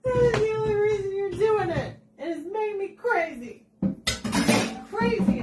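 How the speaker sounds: high, worked-up comic rant; exasperated. Real, not acted. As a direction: rant as if driven crazy, pitched high. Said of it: "it makes me nuts".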